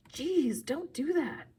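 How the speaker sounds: high-pitched rattled complaint; startled, exasperated protest. A narrator's reading. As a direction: react as if just made to jump. Startled boy scolds invisible friend.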